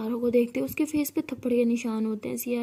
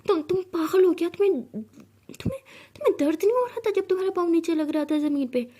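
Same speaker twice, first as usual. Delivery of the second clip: scared and worried; 6 semitones higher than usual. A narrator's reading. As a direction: panic over her bleeding foot. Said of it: "डर जाती है". (डरी हुई, घबराई आवाज़ में)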